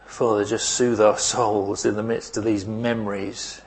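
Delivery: congregational prayer. Acted. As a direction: pray it gently over the congregation. Pastor prays aloud.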